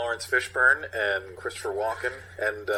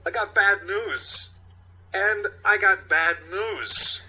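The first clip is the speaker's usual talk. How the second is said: slow, high, loud halting Walken drawl; Walken impression; 9 semitones higher, 6 dB louder, slower than usual. Captain briefing the precinct, in a slow halting Walken voice. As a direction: drag each word out like Walken. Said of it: "Captain Ronnie, played by Walken".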